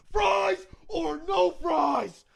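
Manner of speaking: high, slow shout to the back; shouted question. Retold or imitated. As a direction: call back to the passengers. Parent calling across the car.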